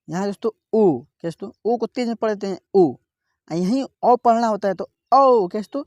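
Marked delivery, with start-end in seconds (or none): 5.1-5.5 ओ held long and louder; drawn-out o. Shown as a demonstration. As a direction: stretch the ओ with force. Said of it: "ओ थोड़ा खींच कर पढ़ते हैं". (ओ को खींचकर ज़ोर से)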